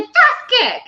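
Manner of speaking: high, loud mock fighter call; announcing the move. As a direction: call out the kick like a game character. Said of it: "She's like".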